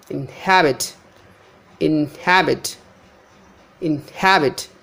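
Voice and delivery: inhabit as in-HAB-it; light in, clear HAB in inhabit; middle-syllable stress. Shown as a demonstration. Teacher showing unstressed prefix, inhabit stressed on HAB. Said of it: "So all these".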